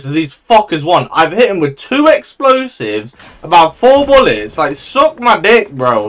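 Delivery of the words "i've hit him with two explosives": ordinary talk, nothing marked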